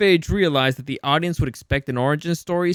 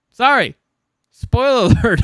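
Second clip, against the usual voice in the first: loud laughing sorry; a real laugh through the apology; laughing out a spoiler alert; 6 semitones higher, 7 dB louder than usual. Real, not acted. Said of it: "apologizing laughing for spoiler".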